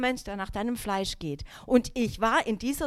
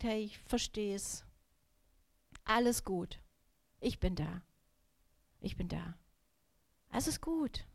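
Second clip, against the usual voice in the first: tender comfort; 2 semitones lower, 9 dB quieter than usual. Retold or imitated. Mother calming her sick son at night, soft and tender. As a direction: soothe him quietly, all love. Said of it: "ich hatte einfach nur noch Liebe".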